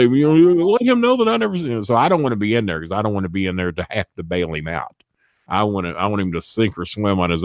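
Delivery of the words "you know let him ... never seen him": high, fast mock whine; a hammed-up frightened plea; pleading whine. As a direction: squeal for help. Tim's imagined plea to Kenny.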